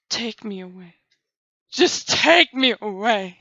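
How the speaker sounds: dreamy swooning plea. Retold or imitated. Smitten by the awesome ninja, dreamy swoon. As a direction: melt and beg him to take you away. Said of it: "I just went".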